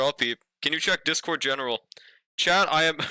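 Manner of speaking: usual speaking voice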